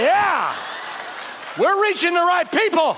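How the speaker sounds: loud, high, laughing mock triumph; ironic shout. Pastor joking to the congregation about the kids, loud and laughing.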